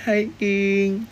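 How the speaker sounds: ordinary talk, nothing marked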